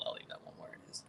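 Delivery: breathy whisper under his breath; hushed whisper, not for the mic; whispered aside. Real, not acted. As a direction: whisper it to yourself, holding back. Muttering to himself after refusing.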